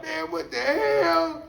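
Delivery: high, slow stunned outcry; disbelief. Retold or imitated. Man seeing the smirking tomcat, slow high disbelief. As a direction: drag it out in shocked disbelief. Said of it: "I'm like".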